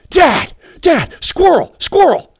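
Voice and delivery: put-on high, loud calling of a thrilled child; a girl tugging at Dad's attention; excited little girl. Daughter excitedly calling about squirrel.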